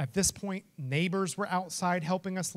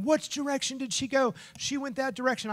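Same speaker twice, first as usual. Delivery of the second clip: mock yelling; 8 semitones higher than usual. Two roommates searching for the dog in hail, mock yelling. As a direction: imitate frantic yelling back and forth. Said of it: "I'm yelling".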